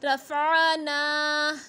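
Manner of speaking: रफ़ाना with the ना held one alif long; slow recitation, रफ़ाना with a long ना; drawn-out vowel. Shown as a demonstration. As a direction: stretch the ना of रफ़ाना. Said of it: "एक अलिफ की मिकदार तक खींच कर पढ़ेंगे".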